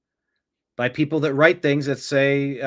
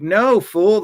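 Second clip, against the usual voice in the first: sharp, loud and higher put-down; scornful retort; 7 semitones higher, 7 dB louder than usual. Retold or imitated. Host scolding Democrats over their angry talking point, loud scornful retort. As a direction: snap it at them like a fed-up parent.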